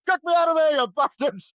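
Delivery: mock trapped yell. Brother imagines Pete trapped, yelling loudly to be freed. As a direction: yell it like you're pinned under an armchair.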